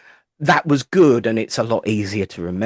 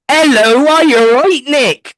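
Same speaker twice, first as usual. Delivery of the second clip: high, loud, cheery Wiltshire hello; a much higher, louder Wiltshire accent greeting a friend on screen; 9 dB louder than usual. Retold or imitated. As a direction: greet him brightly in a broad Wiltshire accent. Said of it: "it was a Wiltshire accent".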